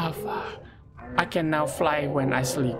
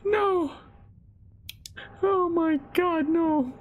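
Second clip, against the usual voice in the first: scared whine; 13 semitones higher, slower than usual. Real, not acted. Dreading the monster.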